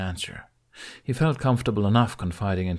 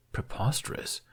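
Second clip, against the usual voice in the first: softer unvoiced whisper of disbelief; 3 semitones higher, 6 dB quieter than usual. A narrator's reading. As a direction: breathe it out under the breath. Muttered in disbelief.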